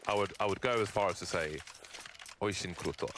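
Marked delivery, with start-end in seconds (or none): 2.4-3.1 "Oishin Kruto" said as a Russian phrase, lower and softer. Shown as a demonstration. Caster praising Waterfalls, saying a Russian phrase. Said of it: "which is Russian for".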